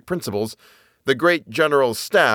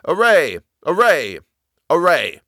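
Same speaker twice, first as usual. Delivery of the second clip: higher cry, each call falling away; thunderous battle cry; 5 semitones higher, 4 dB louder than usual. A narrator's reading. A great voice crying in the soldier's ears, battle cry. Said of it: "a shout louder than a thunder peal crying".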